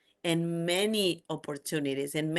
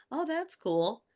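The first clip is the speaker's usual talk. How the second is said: high, light "no big deal" reaction; retold nonchalant reply; 8 semitones higher, slower than usual. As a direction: brush it off lightly, pitched up. Retold casual reply to news.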